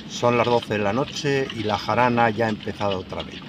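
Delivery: usual speaking voice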